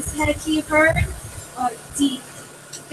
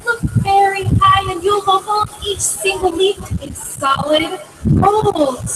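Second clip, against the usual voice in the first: put-on boy's voice; 5 semitones higher than usual. A narrator's reading. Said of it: "cried Jack".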